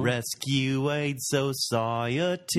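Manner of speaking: spelling it out slowly in a mock Australian accent. Host quoting the Rescuers song, slow Australian accent. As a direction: chant the letters like a slow anthem. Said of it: "Not with that Australian accent".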